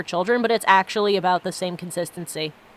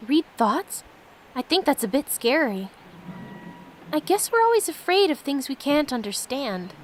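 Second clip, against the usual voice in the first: a touch higher, light playful companion voice; Fran's reply; 5 semitones higher than usual. Acted. Streamer voices companion Fran answering him, lightly higher and playful. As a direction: give Fran a slightly lifted, amused read.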